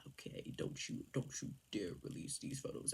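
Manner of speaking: very soft, whispered mock warning; hushed threat. Man warning the photographer over the photos, hushed mock menace. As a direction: imitate a menacing warning under the breath.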